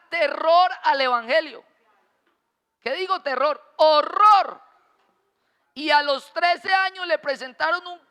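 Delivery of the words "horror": Horror punched high and loud; emphatic correction. Acted. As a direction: land the word Horror hard as the upgrade.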